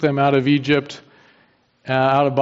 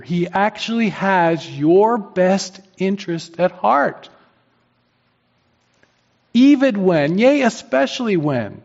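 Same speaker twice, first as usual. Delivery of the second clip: fiery preaching; 4 semitones higher, slower than usual. Acted. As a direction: preach it hard to the congregation. Preaching at full force.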